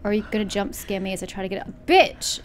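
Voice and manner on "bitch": high loud startled curse; startled yelp. Real, not acted. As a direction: yelp it at the sudden scare. Jump scare.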